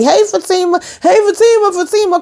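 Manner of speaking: high, mock-desperate calls; calling after her. Beaten man calling after Fatima, mock desperation. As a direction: wail the name like someone begging for rescue. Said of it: "calling after her".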